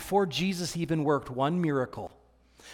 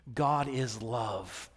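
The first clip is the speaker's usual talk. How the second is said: ordinary delivery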